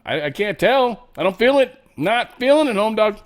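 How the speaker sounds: high, loud mock disbelief; incredulous mock reply. To the deadpan Brits, high loud mock disbelief. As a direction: squeal that you can't tell at all. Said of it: "And you'd be like".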